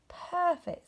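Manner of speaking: high, loud and pleased; delighted. A narrator's reading. Rabbit and Bear admire their snowman, delighted. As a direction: beam it out together.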